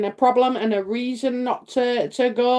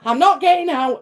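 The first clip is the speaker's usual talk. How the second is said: whiny refusal; 5 semitones higher, 4 dB louder than usual. Retold or imitated. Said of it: "I'd be like".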